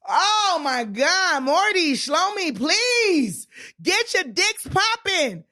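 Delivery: high, slow, drawn-out comic wail; hammed-up plea to God, high and slow; mock prayer plea. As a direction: beg the heavens, stretching every word.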